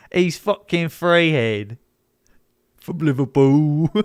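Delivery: slow, hammed-up Liverpool Scouse mockery; mock British accent. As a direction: drawl it like a mocking Scouser. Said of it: "Making fun of a British person's accent".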